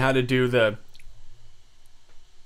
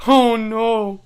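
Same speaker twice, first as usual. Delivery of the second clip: higher, loud cry of dismay; 6 semitones higher, 8 dB louder, much slower than usual. Real, not acted. Streamer reacting to a funny moment, loud dismay. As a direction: wail it out, getting louder.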